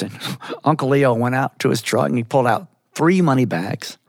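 ordinary delivery, nothing marked

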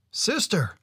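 high, loud call to his sister, out of breath; breathless call. A narrator's reading. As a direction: run in calling out, winded. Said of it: "called Awasos as he and Casco, both completely out of breath".

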